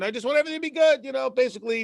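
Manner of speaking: quick, high, carefree rush; lazy contentment. Retold or imitated. Student to the Alter Rebbe, a quick carefree shrug. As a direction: toss it off like you just want peace.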